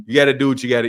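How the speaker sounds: normal talking voice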